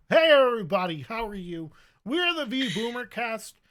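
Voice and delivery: loud upbeat host greeting; podcast host intro. Acted. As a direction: welcome the audience loudly.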